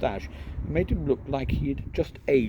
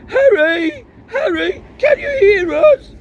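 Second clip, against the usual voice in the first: high, much louder shout through boards; calling out; 13 dB louder, slower than usual. Acted. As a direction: yell to be heard through the wall.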